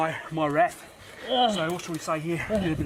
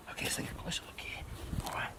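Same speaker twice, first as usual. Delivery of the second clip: breathy hunter's whisper; hushed whisper; 11 dB quieter, faster than usual. Acted. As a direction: whisper it, sneaking up close. Sneaking up on the pony.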